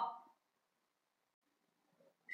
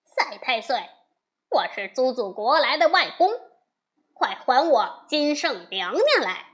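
loud calling out; 5 dB louder than usual. Acted. Wukong shouting at the demon king's gate, loud. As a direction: shout it at the cave door, fast in the middle. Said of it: "高叫道".

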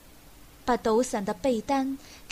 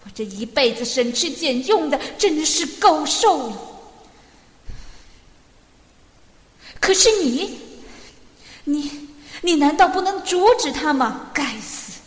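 loud, raised-pitch angry tirade; furious; 4 semitones higher, 7 dB louder than usual. A narrator's reading. Old man blaming daughter-in-law for his drunkard son, furiously. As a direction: rail bitterly at full voice. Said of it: "气冲冲地说着".

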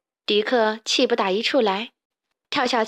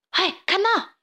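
high, startled call; 9 semitones higher than usual. A narrator's reading. Little Spiky pointing at a fallen pig, calling out. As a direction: draw their attention with a high-pitched cry. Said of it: "小刺头指着墙外倒在球旁边的一头猪喊".

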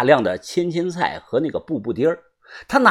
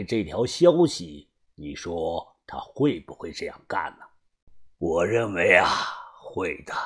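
grave gang boss; 10 semitones lower, 10 dB quieter than usual. A narrator's reading. Boss clears the accused aunt, low and grave. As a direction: speak with quiet, deliberate authority.

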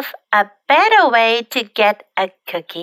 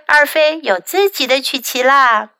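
plain reading voice

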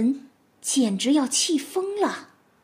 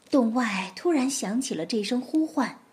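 ordinary delivery

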